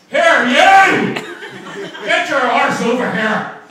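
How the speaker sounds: put-on loud, high Belfast yell in a thick Northern Irish accent; harsh Northern Irish accent. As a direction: bellow it like a harsh Belfast kebab-shop drunk, Northern Irish. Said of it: "it can be pretty harsh".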